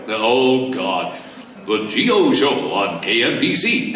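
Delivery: rich radio announcer voice; the old radio jingle, announcer style; Gary Owens impression. Shown as a demonstration. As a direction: play the DJ naming his show. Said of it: "best impression of you".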